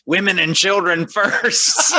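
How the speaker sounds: high, slow creepy chant; skeleton voice. Retold or imitated. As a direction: call it out like a gleeful skeleton. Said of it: "they go".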